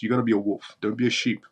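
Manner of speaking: ordinary delivery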